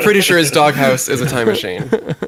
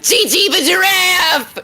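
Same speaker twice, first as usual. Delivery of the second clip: high, drawn-out giraffe squeal; squealed character voice; slower than usual. Acted. Introducing her character to podcast listeners, high squealed cartoon voice.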